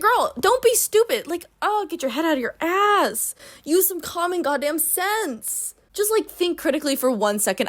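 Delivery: exasperated rant. Real, not acted. Ranting at a character.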